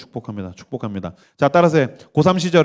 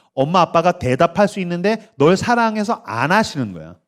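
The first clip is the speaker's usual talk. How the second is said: usual speaking voice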